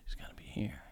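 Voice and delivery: breathy whisper under the breath. Pretend. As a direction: whisper it like a secret. Playful sexy-voice attempt.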